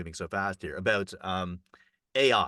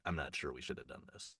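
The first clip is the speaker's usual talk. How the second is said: rueful; 6 semitones lower, 13 dB quieter than usual. Retold or imitated. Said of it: "the Korean guy who created it was like".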